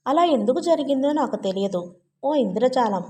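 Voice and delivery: usual speaking voice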